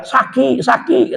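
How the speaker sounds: high, soft mock wail; comic little high-pitched cry of pain; imitated crying in pain. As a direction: whimper in a high voice, pretending to hurt. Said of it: "Nangis, Pak. Sakit-sakit teriak".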